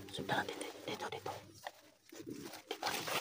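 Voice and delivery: hushed urgent whisper. Real, not acted. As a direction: whisper so nothing hears you. Sneaking after a creature.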